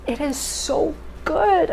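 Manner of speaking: high, slow, gushing praise; delighted. Real, not acted. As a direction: savor every word. Delighted by the taste.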